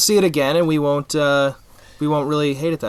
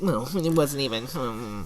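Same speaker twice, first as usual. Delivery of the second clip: grumbling nerd; 7 dB quieter than usual. Retold or imitated. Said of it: "nerds are like".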